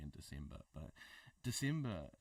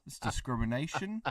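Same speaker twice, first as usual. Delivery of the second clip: mock outrage; 3 semitones higher, 5 dB louder than usual.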